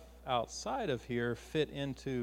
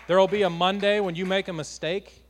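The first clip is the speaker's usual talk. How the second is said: preaching climax; 3 semitones higher, 8 dB louder than usual. Real, not acted. Preaching climax, rising intensity.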